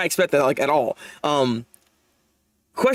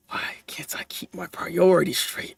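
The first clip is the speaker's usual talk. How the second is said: hushed whisper to himself; breathy inner-voice whisper; whispered thought; 5 dB quieter than usual. Acted. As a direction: whisper it like a private regret. Reading a character's inner thought.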